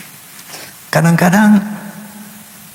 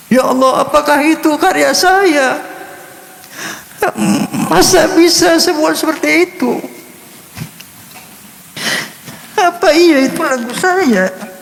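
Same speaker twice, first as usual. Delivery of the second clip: menangis haru. Real, not acted. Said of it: "Saya menangis". (suara tinggi tercekat menahan tangis haru)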